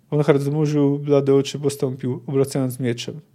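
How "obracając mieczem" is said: ordinary delivery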